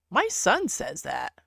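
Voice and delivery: ordinary talk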